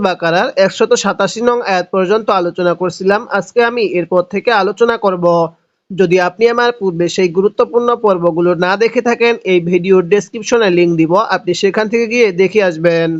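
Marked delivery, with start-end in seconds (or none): none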